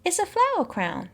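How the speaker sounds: put-on high-pitched little girl voice. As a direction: offer it sweetly as a small child. Voicing a little girl offering crown.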